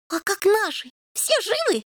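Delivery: high, eager kitten voice; eager questioning. A narrator's reading. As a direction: press anxiously for news. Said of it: "допытывался Рыжий". (взволнованно расспрашивает)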